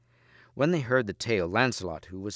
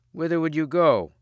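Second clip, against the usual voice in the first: higher, louder rustic challenge; raised voice, pitched up; questioning woodman; 7 semitones higher, 6 dB louder than usual. A narrator's reading.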